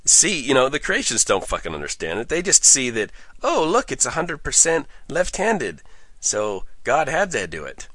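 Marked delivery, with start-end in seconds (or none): 3.4-7.9 mocking creationist.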